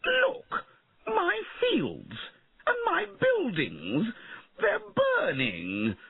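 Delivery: very high, drawn-out wail; high-pitched storybook shriek of alarm; distressed shouting. A narrator's reading. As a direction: cry out in panic, stretching the words. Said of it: "he shouted".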